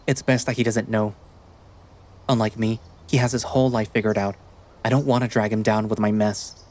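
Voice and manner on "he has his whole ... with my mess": ordinary delivery, nothing marked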